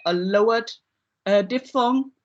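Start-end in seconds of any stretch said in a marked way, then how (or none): none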